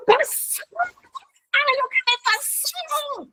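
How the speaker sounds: high, slow, teasing excitement; overjoyed. Retold or imitated. Cris tells the pastor the news, high excited voice.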